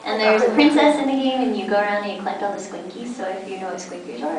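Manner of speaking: usual speaking voice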